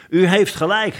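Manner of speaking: high, eager agreement; calling out. A narrator's reading. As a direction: pipe up as a startled minister. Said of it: "De een riep".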